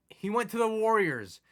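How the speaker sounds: high, stunned but held back; stunned repeat. Real, not acted. Gamer first reports star left, stunned. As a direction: say it once in disbelief. Repeats news, second louder.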